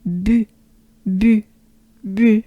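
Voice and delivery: "bu" with rounded French u; French u sound. Shown as a demonstration. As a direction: drill short "bu", evenly spaced. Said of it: "But then it's".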